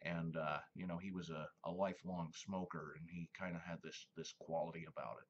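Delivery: low, soft smoker's register; Mel Blanc's real voice. Retold or imitated. Showing viewers Mel Blanc's real voice, low and soft. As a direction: drop into a deep, low smoker voice. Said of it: "he talked kinda like this".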